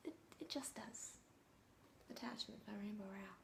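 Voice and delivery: hushed unvoiced aside; a sheepish whispered aside. Real, not acted. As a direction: drop to a whisper, half to yourself. Self-conscious aside after "read a book.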